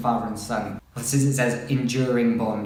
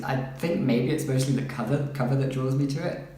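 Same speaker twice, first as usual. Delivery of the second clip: usual speaking voice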